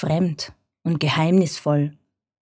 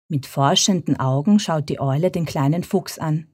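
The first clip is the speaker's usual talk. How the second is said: ordinary delivery, nothing marked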